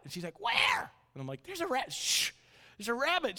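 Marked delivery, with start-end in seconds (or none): 0.4-0.9 put-on loud, high-pitched child's question; toddler daughter. Two-year-old asking dad about the rabbit, toddler squawk. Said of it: "she sounds like that". 1.4-3.4 put-on high, excited parent hushing a child; urgent prompting. Dad hushing and prompting his daughter, urgent.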